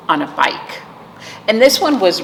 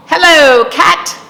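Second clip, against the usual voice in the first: high, loud cheery duck hello; Duck's greeting; 10 semitones higher, 7 dB louder, slower than usual. A narrator's reading. Storyteller voicing Duck greeting Cow, high and loud. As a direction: call out brightly as Duck.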